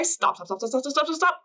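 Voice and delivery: quick repeated mock plea, rising; rapid-fire, half-voiced mock panic; hurried self-stopping. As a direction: frantically talk yourself out of it. Acting out fighting back tears.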